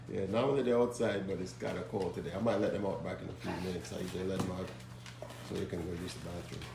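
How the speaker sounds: ordinary talk, nothing marked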